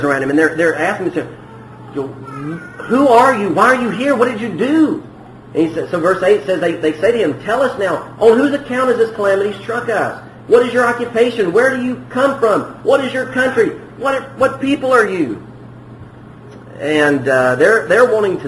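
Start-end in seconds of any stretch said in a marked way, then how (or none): 2.9-5.0 higher, urgent barrage of questions; terrified sailors grilling Jonah, higher pitched; frantic questioning. Retold or imitated. 7.4-15.4 higher, urgent barrage of questions; terrified sailors grilling Jonah, higher pitched; frantic questioning. Retold or imitated. Sailors demand answers from Jonah.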